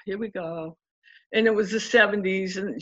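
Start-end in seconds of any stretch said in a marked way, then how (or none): 0.0-0.8 a low, quiet, resigned mutter; sixteen-year-old sighing as the family chaos starts again; weary resignation. Retold or imitated. At sixteen, facing a new stepfamily, quietly resigned. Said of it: "I'm like".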